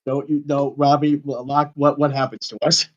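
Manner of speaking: ordinary talk, nothing marked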